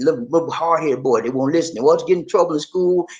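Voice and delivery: ordinary delivery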